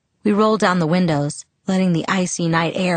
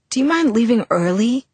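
ordinary delivery, nothing marked

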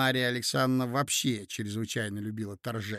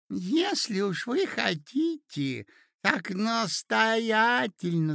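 put-on high, slow, doting old prince; melting, mumbling prince; 8 semitones higher, 3 dB louder, slower than usual. A narrator's reading. Prince confesses to the ladies, melting and mumbling. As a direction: draw it out high, utterly melted. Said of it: "промямлил совершенно растаявший князь".

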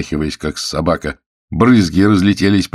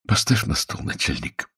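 feeble voice sinking to a whisper; weak pleading; 4 semitones higher, 5 dB quieter than usual. Acted. Shaking prisoner asks interrogator to set mug down, feebly. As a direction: ask weakly, fading out.

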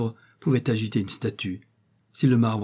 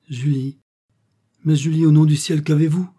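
higher, louder cry of alarm; 5 semitones higher, 7 dB louder than usual. A narrator's reading. Edmond crying out to the stricken Julie, loud and alarmed. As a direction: cry out her name in sudden fear. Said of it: "s'écria Edmond".

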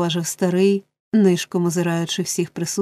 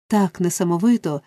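ordinary delivery

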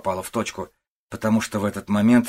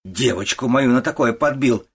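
loud, raised accusation; furious; 4 semitones higher, 6 dB louder than usual. A narrator's reading. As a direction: accuse the suitor in rising rage. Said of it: "ещё сильнее рассвирепела". (гневное обвинение)

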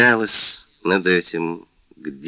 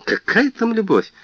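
scornful, much higher; 14 semitones higher than usual. A narrator's reading. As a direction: scoff at the idea. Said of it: "Атенаис презрительно расхохоталась".

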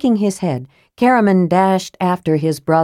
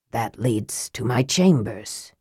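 low, soft, slow musing; to himself; 7 semitones lower, 7 dB quieter, slower than usual. A narrator's reading. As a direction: murmur it slowly to yourself. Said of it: "he said to himself".

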